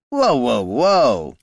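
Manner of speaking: feigned alarm, palms up; mock-placating thug; bandit backing off. A narrator's reading. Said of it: "said the first man".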